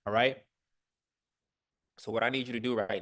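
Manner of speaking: loud rising call to the group; rally the room with a sharp check-in. Real, not acted. Coach calling out to the whole group, loud and rising.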